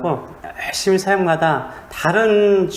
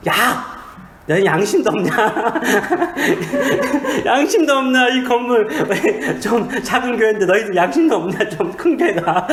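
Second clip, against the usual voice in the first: mock yell; 6 semitones higher than usual. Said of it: "그럼 제가 소리 질러요".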